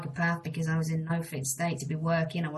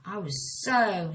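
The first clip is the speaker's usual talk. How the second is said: ordinary talk, nothing marked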